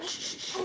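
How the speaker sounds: soft unvoiced shushing. Real, not acted. Hushing crying baby.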